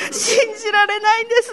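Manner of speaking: a touch of crying in a retold confession; choked-up retelling of her own words; tearful. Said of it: "ドワーッと泣けて".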